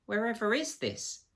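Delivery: tired and pale, lost in the darkness. A narrator's reading. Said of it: "sounding tired and pale in the darkness".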